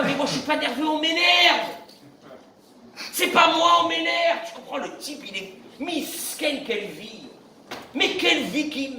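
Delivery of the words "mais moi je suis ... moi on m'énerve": raised, high indignant protest; quoted excuse. Retold or imitated. Rabbi quoting a man blaming others, high indignant protest. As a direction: protest your innocence like him. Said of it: "Quelqu'un m'a dit une fois".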